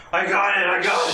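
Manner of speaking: a player faking an orc voice from under the tent. Pretend. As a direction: bark it out like a clumsy orc. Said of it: "I sort of try to imitate an orc voice".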